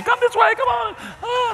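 high sing-song guide calling the crowd; tour guide call. Retold or imitated. Guide with flags calling her group, sing-song high. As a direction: herd the group along.

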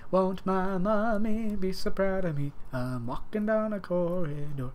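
lightly voiced dreamy kid; mock inner voice. Gamer pretending to be Riley, slow and hushed.

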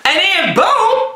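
high, stretched-out "boom"; hyped intro call. Acted. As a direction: launch the intro with a bang. Retake of the video intro.

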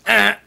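sudden loud mock death cry; a short loud "Ah!" as the lens croaks; dying lens cry. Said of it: "before it croaks and goes".

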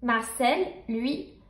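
ordinary delivery, nothing marked

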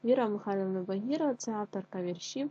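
ordinary talk, nothing marked

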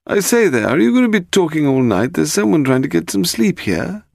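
high, peevish voice of a disturbed sleeper; indignant calling out. Acted. Man on the boat to noisy visitors, indignant high call. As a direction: call out in a high-pitched complaint. Woken boat owner calls out.

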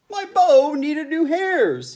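high, loud critter voice; raccoon voice. A narrator's reading. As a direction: pop in as a raccoon.

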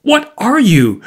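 high-pitched alarmed question; cried out. A narrator's reading. Markheim demanding who the visitor is, crying out. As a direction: cry out, pitch leaping up. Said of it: "cried Markheim".

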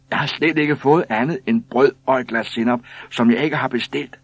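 loud, high, insistent grievance; shrieked complaint. A narrator's reading. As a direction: pile in with an angry protest. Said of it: "skreg en tredje".

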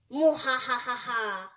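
hammed-up evil cackle; mad scientist laugh. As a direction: cackle like a cartoon villain. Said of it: "You really need to work on that".

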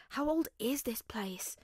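slow awed whisper; hushed whisper. A narrator's reading. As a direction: breathe the question in awe. Hushed wonder at old room.

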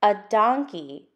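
lively, sing-song picture book reading; reading to children. Acted. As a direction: read it out for little listeners, building the list. Reads the picture book aloud.

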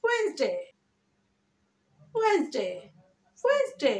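WEDNESDAY as high, quick "WENZ-day"; smart pronunciation. Shown as a demonstration. Teacher answering what we will say, high "wenz-day" smart pronunciation. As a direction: squeeze ওয়েডনেসডে into two beats, high then falling. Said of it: "বলবো কি?".

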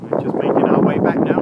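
loud voice over the wind; shouting over wind. Real, not acted. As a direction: raise the voice against gusts. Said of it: "hear me through the wind".

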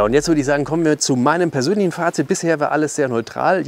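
normal talking voice

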